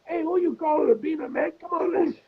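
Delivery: high, quick comic stoner voices; squabbling stoners. Retold or imitated. Acting out two sketch stoners arguing in class, high mock squabble. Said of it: "And then Chong is like".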